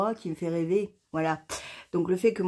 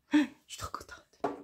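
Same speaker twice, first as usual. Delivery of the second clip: a gasp, then a high whisper of delight; 8 semitones higher, 4 dB quieter than usual. Real, not acted. Sister thrilled her brother is coming, gasping whisper. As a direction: breathe it out, overjoyed.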